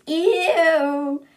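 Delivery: loud, high, drawn-out squeal of disgust; disgusted shriek. A narrator's reading. Someone on board hears he's being affectionate, disgusted shriek. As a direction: shriek it in revulsion. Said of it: "someone on board shrieks".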